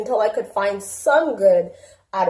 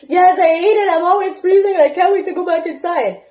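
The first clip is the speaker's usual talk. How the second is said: put-on higher, louder complaining child voice; whiny daughter; 9 semitones higher, 6 dB louder than usual. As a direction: mom playing her daughter whining about recess.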